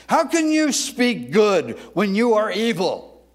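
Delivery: loud, high, accusing preacher's question; angry rebuke. Acted. Jesus accusing the Pharisees, a loud high rebuke. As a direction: hurl the question at them. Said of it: "Now, he says".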